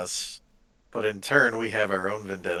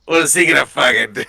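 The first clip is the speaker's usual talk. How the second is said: fast, loud, fired up; excited shout; 4 semitones higher, 14 dB louder, much faster than usual. Real, not acted. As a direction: shout it with cocky glee. Excited war talk.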